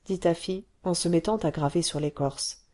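ordinary delivery, nothing marked

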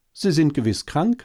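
hohe Äffchenstimme, hilfsbereit; hilfsbereites Äffchen. A narrator's reading. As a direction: sag es besorgt, wie ein kleines Äffchen. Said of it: "sagte das Äffchen und kletterte hilfsbereit". (hoch und hilfsbereit)

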